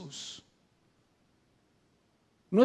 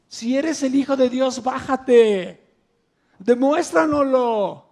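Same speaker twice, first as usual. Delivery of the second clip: mocking shout; 3 semitones higher, 6 dB louder, slower than usual. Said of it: "le gritaba a la gente".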